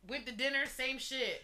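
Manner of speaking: ordinary delivery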